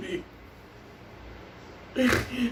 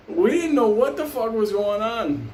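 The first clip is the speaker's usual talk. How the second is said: ordinary delivery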